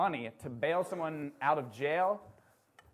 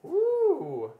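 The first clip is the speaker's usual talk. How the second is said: ooh; 13 semitones higher, 8 dB louder than usual. Real, not acted. Reacting to pickup line.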